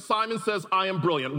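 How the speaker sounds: ordinary talk, nothing marked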